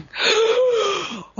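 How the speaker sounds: sharp high gasp of horror; shocked gasp. Retold or imitated. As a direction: gasp as if caught out. Shocked on realizing it's Steamboat.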